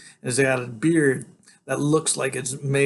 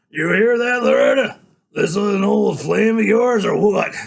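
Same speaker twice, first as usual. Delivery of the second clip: loud, raised tough-guy voice; taunting biker boss; 8 semitones higher, 9 dB louder than usual. A narrator's reading. Tattooed dude teases Loretta about an old flame, loud taunting. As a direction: jeer loudly at them.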